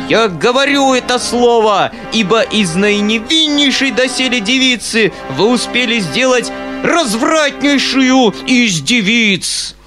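high, loud shrill denunciation; squealing. A narrator's reading. Foma shrieks his final insult at the uncle. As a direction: finish the insult in triumph. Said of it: "визжал Фома".

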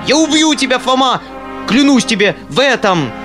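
high, loud enraged vow; furious threat. Acted. Uncle threatens to kill Foma, enraged. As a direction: swear it in rage.

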